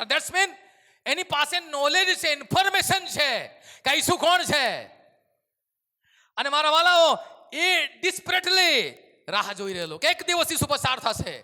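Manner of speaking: loud, high preacher's cry; rising revival sermon shout; fiery preaching. Acted. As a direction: preach it out with full force. Preaching at full force.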